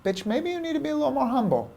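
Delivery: a quick, high, teasing put-down; sassy inner voice. Retold or imitated. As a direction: deliver it as a cheeky inner voice putting him in his place. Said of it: "whispered into my ear".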